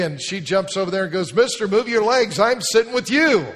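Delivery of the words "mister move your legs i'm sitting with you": put-on high, loud bossy child voice; bossy little girl. Girl telling the pastor to move his legs, high and bossy. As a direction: order the man to move like a pushy kid.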